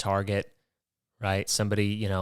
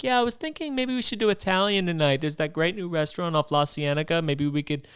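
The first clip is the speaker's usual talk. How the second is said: much higher, breezy mock date voice; chirpy and clueless about dinner; oblivious girlfriend; 5 semitones higher than usual. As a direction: girlfriend ignoring the cannibal line, chirpy. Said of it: "And they're like".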